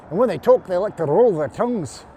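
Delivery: loud, quick Scottish accent with rolled r. Shown as a demonstration. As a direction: roll the tongue, Scottish style. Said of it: "They go like this".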